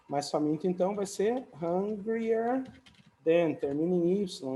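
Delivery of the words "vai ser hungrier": ordinary talk, nothing marked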